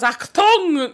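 barked mock German command; German accent. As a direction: bark it in a hammy German accent. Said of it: "fluctuates between New York and German".

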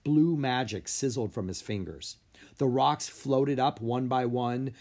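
ordinary delivery, nothing marked

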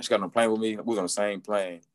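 ordinary delivery

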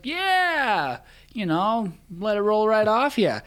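breezy, forced-casual shrug-off; mock easygoing. To friends after slipping on ice, mock easygoing. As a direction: play it off like nothing hurt. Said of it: "just super easygoing".

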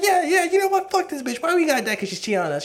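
high, squeaky mocking voice of a fed-up guy; cartoonish high indignant whine; fed-up boyfriend. Guys turning on girlfriend in story.

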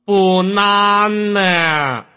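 Banana stretched very slowly, loud and high; Banana sung out slow and loud like a teacher; long drawn-out vowels. Shown as a demonstration. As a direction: draw out every syllable of Banana. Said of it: "要拉长音".

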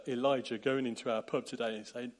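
ordinary talk, nothing marked

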